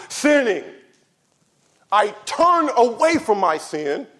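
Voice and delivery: loud, high, slow preaching at full force. Acted. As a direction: thunder it from the pulpit.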